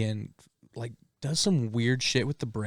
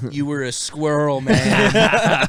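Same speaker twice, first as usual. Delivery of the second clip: laughing stoner voice; 7 dB louder, much slower than usual. Acted. Mock stoner past-life joke.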